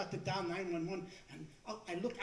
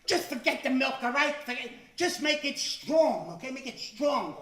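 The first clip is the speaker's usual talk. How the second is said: loud, higher, slowed-down demand; exasperated shout; 4 semitones higher, 9 dB louder than usual. Retold or imitated. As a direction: slowly spell out that he wants it strong. Fed-up customer yells at barista.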